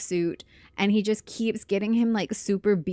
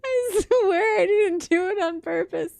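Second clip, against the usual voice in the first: laughing; 9 semitones higher, 4 dB louder than usual. Real, not acted. Giggling after awkward game moment.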